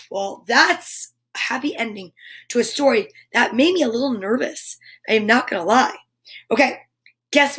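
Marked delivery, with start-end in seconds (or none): none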